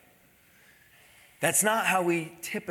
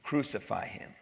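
much lower, softer repeat; hushed echo; 8 semitones lower, 9 dB quieter than usual. Retold or imitated. Preacher echoing the fickle crowd's demand, hushed and low. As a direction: let the crowd's demand sink to a hush.

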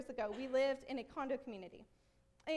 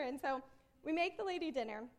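usual speaking voice

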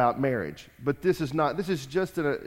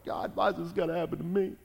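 whiny complaining to God, half-hammed; mock pouting whine; 2 semitones higher than usual. Sulking believer whines to God, mock pout. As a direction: pout it out like a sulky child. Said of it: "you're pouting to God and going".

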